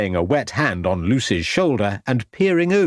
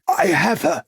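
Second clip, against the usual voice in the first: spluttering; 7 semitones higher than usual. A narrator's reading. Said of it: "spluttered Shorter".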